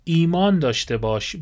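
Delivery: usual speaking voice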